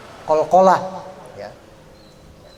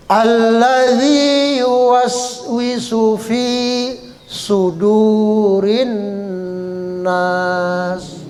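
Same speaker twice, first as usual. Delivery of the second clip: zal as th sound; 3 semitones higher, much slower than usual. Shown as a demonstration. Teacher modelling the zal sound, slow chant. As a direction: bite the tongue tip for the z in "Allazi".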